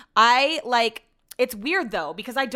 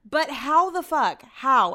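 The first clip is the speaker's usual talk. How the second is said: incredulous; 5 semitones higher, 5 dB louder, slower than usual. Real, not acted. Incredulous rant.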